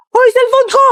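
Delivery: high, loud yell after a thief; calling out. Acted. Schoolgirl yells at the thief who grabbed her phone, high and loud.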